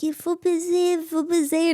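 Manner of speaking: high-pitched imitation of a French-speaking clerk; Swiss shop woman. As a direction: chirp it as the insistent shop woman. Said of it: "the woman's like".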